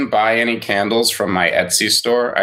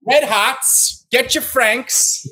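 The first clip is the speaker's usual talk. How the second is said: 1920s boardwalk vendor; 13 semitones higher, much slower than usual. Pretend. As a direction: bark it like a hot dog seller on a 1920s boardwalk. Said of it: "Atlantic City Boardwalk circa nineteen twenty-two".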